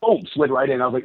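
ordinary talk

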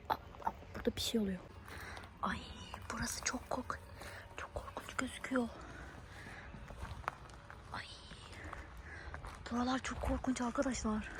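hushed, breathless whisper of fear; scared whisper. Acted. Creeping through an abandoned building, frightened whisper. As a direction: whisper as if something lurks nearby.

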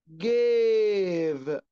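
جيف as a very stretched "gave"; long A in جيف. Shown as a demonstration. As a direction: hold the "ay" of جيف before the v. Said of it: "أنا بمد في الإيه". (مدّ الإيه في «جيف»)

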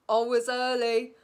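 laughing and loud. Real, not acted. Admitting being early, laughing. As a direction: laugh through the words.

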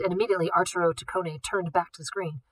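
plain reading voice

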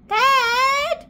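long, high mock wail; screaming. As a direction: wail for a parent like a child in a tantrum. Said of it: "I'm screaming".

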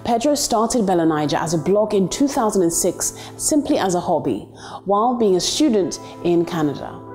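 scripted profile voiceover with lifted phrase openings; documentary narration. A narrator's reading. Introducing the founder of a digital media business, narrated profile read.

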